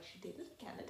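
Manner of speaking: hushed, whispery bougie aside; bougie self. Retold or imitated. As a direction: whisper it like an impressed snob. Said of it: "my bougie ass is like".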